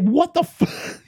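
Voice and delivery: high, slow, stunned outburst; shocked. Retold or imitated. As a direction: cut off mid-swear in disbelief. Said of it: "I'm like".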